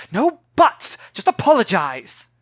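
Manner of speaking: loud, snapping grown-up order; sharp command. Acted. Angry woman cutting off the girl's excuse. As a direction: cut the child off hard. Adult cuts child off.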